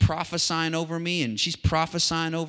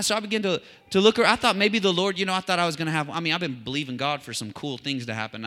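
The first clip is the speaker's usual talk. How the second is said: ordinary delivery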